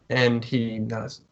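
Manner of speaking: ordinary talk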